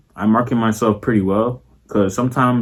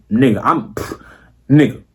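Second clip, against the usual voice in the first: ordinary delivery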